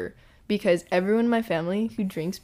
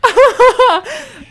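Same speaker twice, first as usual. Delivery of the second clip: very high, loud burst of laughter; real high-pitched cracking up; 12 dB louder than usual. Real, not acted. Reacting to death joke.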